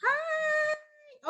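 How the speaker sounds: very high, soft hi; teacher greeting Malaya; sing-song hi. Real, not acted. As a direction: coo a greeting. Greeting student.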